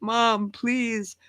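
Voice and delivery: put-on high, drawn-out childish begging; imitated pleading little boy. Little son begs mom from the porch, mock pitiful. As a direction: beg like a small kid locked out. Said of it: "and he's like".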